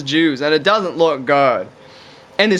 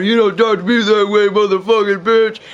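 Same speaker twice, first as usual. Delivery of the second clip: put-on angry yell, only mildly raised; mock screaming cop; 3 semitones higher than usual. Cop screaming out as the guy drives off, mock yell. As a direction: imitate a cop screaming after someone. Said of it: "the cop is screaming out".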